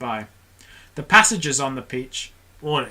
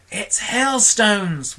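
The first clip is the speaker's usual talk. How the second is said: excited whisper; 7 semitones higher, much slower than usual. Acted. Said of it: "whispered James excitedly".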